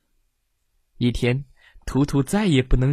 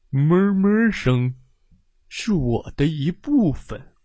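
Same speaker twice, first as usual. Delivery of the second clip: mild cow voice; 3 semitones higher, 3 dB louder than usual. Acted. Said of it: "母牛卡西慢慢转过头，他嚼着草说".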